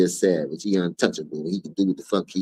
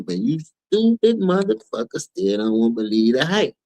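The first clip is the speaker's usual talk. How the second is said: ordinary talk, nothing marked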